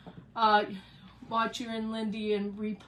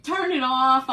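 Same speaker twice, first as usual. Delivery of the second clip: raised voice, higher and much louder; loud protest; 5 semitones higher, 10 dB louder than usual. Real, not acted. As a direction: call it out loudly across the room. Comic protest when caught with AC on.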